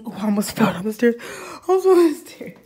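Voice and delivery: loud startled exclaim. Real, not acted. Nearly falls on the stairs.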